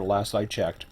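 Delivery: normal talking voice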